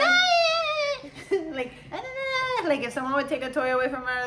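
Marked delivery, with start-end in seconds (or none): 0.0-1.1 high, loud imitated toddler squeal; mock baby shriek, very high and loud; mimicked toddler scream. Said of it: "and she'd be like". 1.9-2.7 put-on repeated high, loud baby cries; high-pitched mock baby babble-yell; mimicked toddler yelling. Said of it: "and she'd be like".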